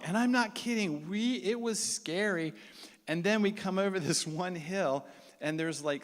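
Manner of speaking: usual speaking voice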